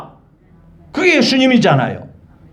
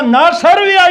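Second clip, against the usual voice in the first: loud, higher summons of 나사로야; calling out; 9 semitones higher, 4 dB louder, slower than usual. Acted. Jesus calling Lazarus out of the tomb, loud summons.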